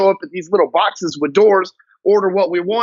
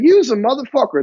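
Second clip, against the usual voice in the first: higher-pitched playful jab; good-mood teasing; 6 semitones higher than usual. Acted. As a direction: toss it out like a cheerful greeting to a buddy. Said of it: "you could be in a good mood".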